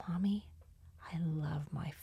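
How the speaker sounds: put-on soft, slow whispered kid voice; hushed little-kid quote. Mom quietly imitating her son's cute line. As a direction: breathe it like a small child confessing.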